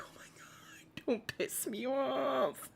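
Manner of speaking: slow, hushed, fed-up whisper; exasperated whisper. Retold or imitated. A stressed mom whispers at her kid, exasperated hush. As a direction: grit it out under your breath. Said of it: "And you're like".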